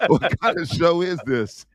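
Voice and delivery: high, slow, giggling disbelief; laughing. Real, not acted. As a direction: laugh through the words. Laughing at accent joke.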